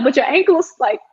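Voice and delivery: high-pitched, mock-worried objection; concerned protest. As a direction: object in a squeaky, retold concern. Retold worried protest to injured co-star.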